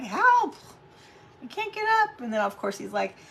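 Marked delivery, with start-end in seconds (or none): 0.0-2.2 high, loud playful yell for help, much higher; mock cry for help. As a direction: call out like you're stuck on the floor, half-joking.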